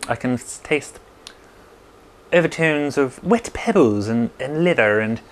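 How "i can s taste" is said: normal talking voice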